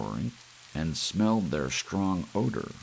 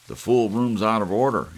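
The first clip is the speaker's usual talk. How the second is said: loud, high exasperated complaint; frustrated outburst; 6 semitones higher, 10 dB louder, faster than usual. Acted. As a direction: vent at the broken room. George frustrated at room.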